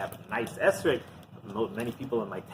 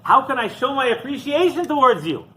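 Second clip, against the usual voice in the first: high, loud cry to heaven; elated, soaring exclamation of thanks; acted excitement; 11 semitones higher, 6 dB louder than usual. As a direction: call up to God, overjoyed. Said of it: "He's so excited".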